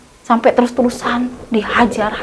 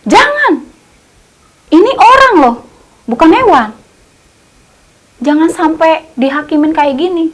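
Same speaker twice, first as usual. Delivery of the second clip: high pleading cry; 5 semitones higher than usual. Retold or imitated. Someone pleads stop the mob.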